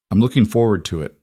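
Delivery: ordinary delivery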